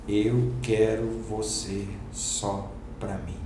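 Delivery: slow, hushed divine voice; voice of God. Retold or imitated. God claiming him for Himself, slow and hushed. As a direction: say it slowly and softly, like God speaking. God speaks through a mist.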